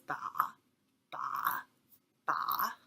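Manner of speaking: BA falling low, then rising a little; third tone. Shown as a demonstration. Teacher showing third tone, BA dipping and rising. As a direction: dip BA down low, then partly back up. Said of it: "first falls and then goes up".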